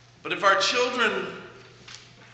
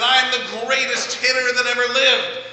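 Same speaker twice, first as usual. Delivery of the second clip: put-on higher, louder boyish brag; confident boast; 7 semitones higher, 6 dB louder than usual. Pastor voicing the boy bragging before his third swing, loud high boast. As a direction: puff up and proclaim it to the world. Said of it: "and he said".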